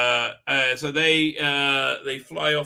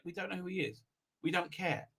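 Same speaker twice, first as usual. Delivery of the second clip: mock quiet; 3 semitones higher, 13 dB quieter, faster than usual. Mocking the writing's attitude.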